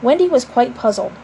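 ordinary delivery, nothing marked

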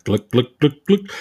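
mock glugging noise; cartoon drinking sound; imitated gulping. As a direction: glug it down in quick spoken gulps.

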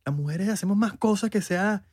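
high-pitched put-on indignation; high mock protest; mock feminist. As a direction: parody an activist arguing back. Parodies an offended feminist.

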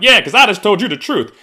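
high, loud smug retort; defiant retort. Retold or imitated. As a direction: fire it back smugly. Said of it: "I'm like".

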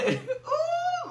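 a falsetto ooh through laughter; laughing high ooh. Real, not acted. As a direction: lift a laughing cry up high. Preacher overcome mid-sermon.

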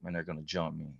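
quiet dread. Retold or imitated.

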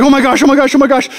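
hammed-up alarm without a gap; mock freaked out. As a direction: imitate a panicking driver, phrases piled on each other. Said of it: "he's freaked out".

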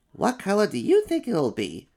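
high, curious little monster voice; an eager small kid-monster. Acted. First little monster asks about the fur color.

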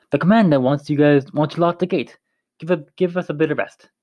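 a guard faking an Irish brogue to fool the men; best Irish accent. Acted. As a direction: bluff through it in a forced Irish accent. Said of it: "mustered his best Irish accent he could".

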